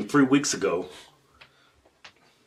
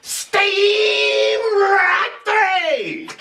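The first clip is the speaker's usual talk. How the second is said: high slow sung shout, laughing; sing-song umpire call; much slower than usual. Acted. Mocking umpire drags out the strike call, sing-song. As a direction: drag it out like a chant.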